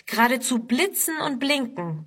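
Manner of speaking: ordinary delivery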